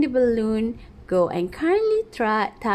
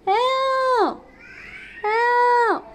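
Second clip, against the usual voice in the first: calling for help; 12 semitones higher than usual. Acted. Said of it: "Dia minta tolong".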